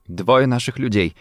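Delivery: ordinary delivery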